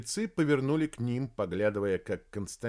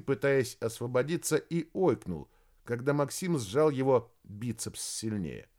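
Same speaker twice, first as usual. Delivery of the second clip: ordinary delivery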